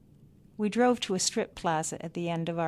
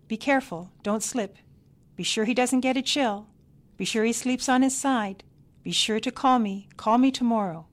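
louder, higher string of fussy orders; shouted instructions; 5 semitones higher, 7 dB louder than usual. A narrator's reading. Grandmother shouts after the departing parents, fussy instructions. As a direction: call after them as they leave. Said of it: "Miney shouted instructions as we walked to the car".

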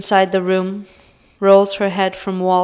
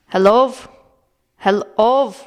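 raised, shouting Hello into an intercom; shouting over intercom; 3 semitones higher, 4 dB louder than usual. A narrator's reading. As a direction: call out loudly, an old man yelling. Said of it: "Grandpa screams in Russian over the intercom".

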